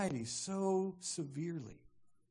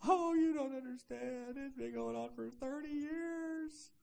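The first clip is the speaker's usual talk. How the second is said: hammed-up self-pity, pitched way up; whining passive victim; 8 semitones higher than usual. Victim insisting nobody understands, high exaggerated whine. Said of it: "that passive victim".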